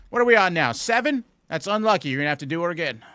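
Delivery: loud, high, hammed-up furious yell; mock upset yelling. Shown as a demonstration. Streamer demonstrating what upset sounds like, loud mock yell. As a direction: scream it like a betrayed partner.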